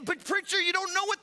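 a wronged listener's high, loud complaint; protesting congregant. Acted. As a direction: whine the excuse up high like a hurt church member. Objector's excuse again, cut off.

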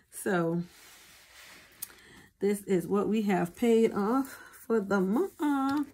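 ordinary talk, nothing marked

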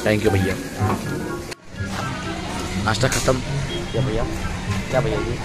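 ordinary talk, nothing marked

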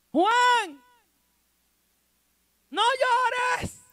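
high, loud scolding call; mock scolding. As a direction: scold sharply from the pulpit, pitched high. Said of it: "lo mandaron a regañar". (regaño fingido, agudo y fuerte)

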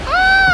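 short, loud, high "ah"; excited scream. Real, not acted. Streamer overwhelmed by the action, excited scream. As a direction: let out a high shriek. Overexcited during gameplay.

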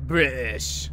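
exaggerated British accent in an altered voice; mock British accent. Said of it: "'Cause I'm British".

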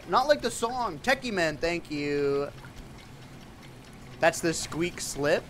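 ordinary talk

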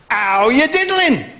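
high, slow catchphrase greeting; cheery catchphrase. Acted. Warm-up man greets the audience, high and drawn out. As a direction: sell the catchphrase to the crowd. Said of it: "top warm-up man".

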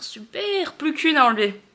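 super jumps high with a rise; sarcastic high super. Real, not acted. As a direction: fling it up in mock cheer. Sarcastic reaction to swollen cheek.

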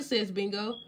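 ordinary delivery

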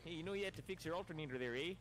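hammed-up Canadian, pitched high; mock Canadian yokel talk. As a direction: put on a cartoon Canadian with a rising eh. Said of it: "like they, they think we say it".

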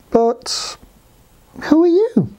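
drawn-out start, long pause, high question; ponder, then ask like a sluggish snail; halting storybook snail voice; slow snail. Acted.

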